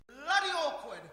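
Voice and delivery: exasperated outburst. Acted. Exasperated at brother.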